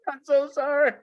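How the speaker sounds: high, loud sobbing wail of apology. Retold or imitated. Secretary sobbing her apology to the doctor on the phone.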